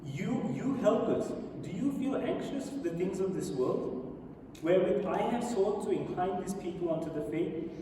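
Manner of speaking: formal recitation, lifting the openings of phrases; solemn oratory. A narrator's reading. Prophet's plea to his helpers, read as solemn oratory. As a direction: read aloud as a heartfelt address. Reads the Prophet's speech aloud.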